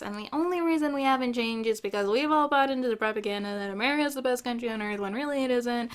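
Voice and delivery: ordinary talk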